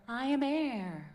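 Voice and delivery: put-on light, slow, airy and childlike; air voice. Shown as a demonstration. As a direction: float it up lightly. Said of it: "What if we go to air?".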